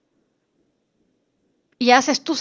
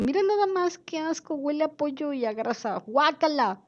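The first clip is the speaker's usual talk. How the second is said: higher, grossed-out mock whine; disgusted complaint; 6 semitones higher than usual. Customer complaining about the greasy table, mock disgusted. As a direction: turn your nose up at the dirty table. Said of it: "yo me quejo de que huele feo".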